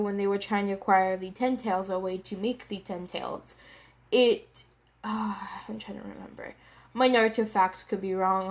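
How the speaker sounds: normal talking voice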